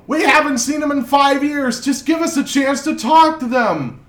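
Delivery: angry crowd. Acted. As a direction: demand answers as an upset crowd. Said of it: "rumble and mumble".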